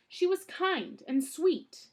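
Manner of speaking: high, loud protest; indignant cry. A narrator's reading. Klaus protesting to Count Olaf, high loud cry. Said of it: "Klaus cried".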